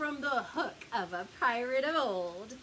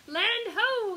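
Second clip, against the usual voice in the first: loud pirate shout; hearty pirate yell; 9 semitones higher, 7 dB louder than usual. A narrator's reading. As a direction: call it out across the sea. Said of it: "yelled the pirates".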